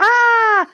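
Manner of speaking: sudden high yelp; a short flustered squeal; startled shriek. Real, not acted. Flustered shriek at being called.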